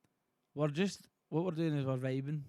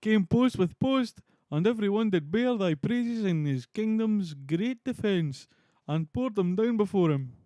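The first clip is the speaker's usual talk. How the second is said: loud, higher, slow declamation; Ross, grand herald; 6 semitones higher, 7 dB louder, slower than usual. Acted. Streamer reading Ross's praise of Macbeth, loud and theatrical. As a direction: proclaim it like a stage messenger.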